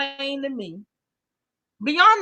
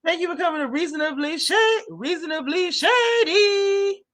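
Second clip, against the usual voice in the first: high, slow, louder mock hostess welcome; mocking Giselle's yell; 6 semitones higher, 4 dB louder than usual. Mocking Giselle's overdone welcome to the show. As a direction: stretch it out like an overeager host. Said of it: "Why was Giselle yelling?".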